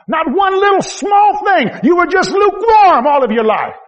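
high, slow, loud rebuke; voicing Jesus' rebuke, loud; stern rebuke. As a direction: shout it down as judgment. Jesus rebuking lukewarm church.

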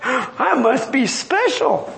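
slow, self-satisfied relief; drawn-out pleased with himself; smug Jonah. Retold or imitated. Preacher voicing Jonah admiring the gourd, smug and slow. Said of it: "Jonah says".

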